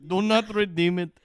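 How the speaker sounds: mock Indian scam caller. Imitating a scam caller begging not to redeem, loud mock Indian accent. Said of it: "It's about those scam calls".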